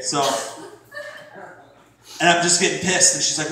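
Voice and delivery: ordinary delivery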